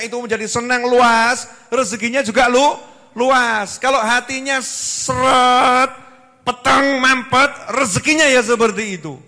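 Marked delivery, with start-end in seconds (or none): 4.6-7.5 "seret" diulur panjang, lambat dan keras; tekanan pada seret. Shown as a demonstration. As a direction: tekan keras "seret", "peteng", "mampet" satu-satu. Menggambarkan hati yang sempit dengan suara. ("seret" diseret panjang, banter)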